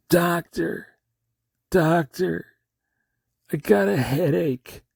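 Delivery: high-pitched, pleading patient; prisoner calling doctor. Acted. Acted prisoner calls to doctor.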